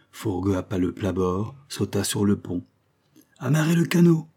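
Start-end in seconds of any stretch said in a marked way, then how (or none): 3.4-4.3 high, loud captain's command; barked order. A narrator's reading. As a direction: call the order out to the crew. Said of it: "ordonna-t-il".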